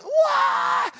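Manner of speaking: high, loud yell of alarm; a sudden high cry of warning; mock crowd yell. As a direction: shriek it like the watching family. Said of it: "they're just all going".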